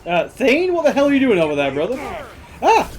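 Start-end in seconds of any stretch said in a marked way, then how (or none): 2.6-2.9 startled yelp. Real, not acted. Startled by game character.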